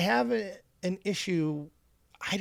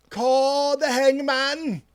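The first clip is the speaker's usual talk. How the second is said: high, loud, drawn-out town-crier call; mock-grand proclamation; 9 semitones higher, 6 dB louder, much slower than usual. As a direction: proclaim it slowly with pompous drama. Mocking self-important online poster.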